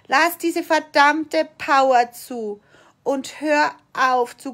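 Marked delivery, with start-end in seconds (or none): 0.1-2.6 loud, slow, emphatic command; emphatic demand, drawn out; forceful urging. Shown as a demonstration. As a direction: drive it home word by word, with force. Same urging repeated with force.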